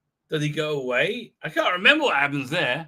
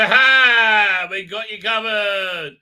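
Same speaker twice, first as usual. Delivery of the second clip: loud triumphant cry, then slower; mock ambush shout; 3 semitones higher, 8 dB louder, slower than usual. Guards springing their ambush, loud mock triumph. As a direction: burst in like you caught them. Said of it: "come up and say".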